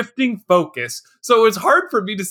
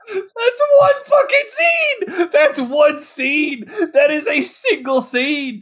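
slow, high, laughing outrage; incredulous outburst; 5 semitones higher, slower than usual. Real, not acted. Co-host ranting about a one-shot scene, outraged. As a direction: drag it out in disbelief, nearly laughing.

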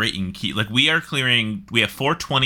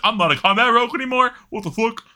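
high, fast outraged complaint; indignant disbelief; 12 semitones higher, 4 dB louder, faster than usual. Retold or imitated. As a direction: blurt it out, offended and confused. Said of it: "are like".

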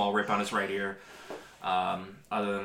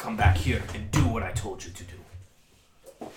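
dwarf boss snapping orders; gruff dwarf boss. Acted. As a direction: lay down the law to the boy. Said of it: "dwarven man goes".

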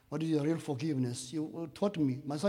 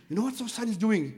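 imitating angry investigator; 6 semitones higher than usual. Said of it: "He was angry".

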